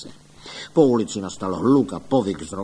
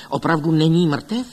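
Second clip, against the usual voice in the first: louder, higher, rising disbelief; urgent, hopeful raised question; eager question; 6 semitones higher, 6 dB louder than usual. A narrator's reading. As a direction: ask eagerly for confirmation. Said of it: "tázal se dychtivě".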